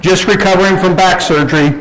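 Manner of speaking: ordinary delivery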